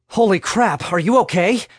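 excited, anxious exclamation; alarmed concern. A narrator's reading. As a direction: blurt it out, startled and worried. Friend rushes over after fall.

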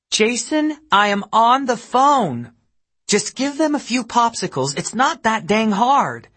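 high, loud exasperated yell. A narrator's reading. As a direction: snap at the husband from afar. Mother yelling at husband.

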